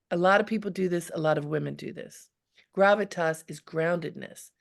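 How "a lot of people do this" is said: ordinary delivery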